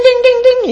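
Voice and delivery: high ringing dings; ding like a little bell; imitated alarm bell.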